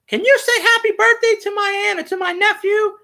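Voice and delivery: high-pitched fan lady asking a favor; imitating excited ladies. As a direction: squeal the request like an eager fan. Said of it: "there's ladies in the background".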